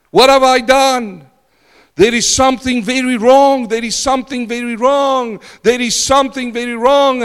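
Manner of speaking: high, loud anguished cry; dying man's terror. Retold or imitated. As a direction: cry out in horror at the end. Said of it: "he said".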